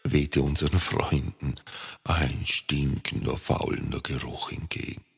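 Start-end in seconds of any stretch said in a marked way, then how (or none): none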